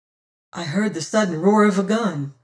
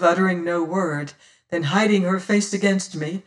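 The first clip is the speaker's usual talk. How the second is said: ordinary delivery, nothing marked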